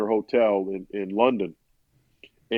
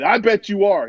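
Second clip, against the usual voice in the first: sharply higher accusing retort; building anger; 8 semitones higher, 3 dB louder than usual. Retold or imitated. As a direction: throw it back as a mock-angry accusation. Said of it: "building this rage and this anger".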